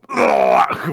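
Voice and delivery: loud, low retching "ugh"; disgusted guttural heave; loud gagging groan. Shown as a demonstration. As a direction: demonstrate being revolted with a big gag. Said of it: "designed to make you go".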